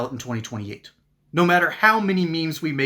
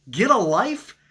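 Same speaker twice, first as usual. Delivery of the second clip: scoffing echo, pitched higher; mocking disbelief; 5 semitones higher, slower than usual. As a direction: repeat the insult as if it's childish. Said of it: "what is this, third grade?".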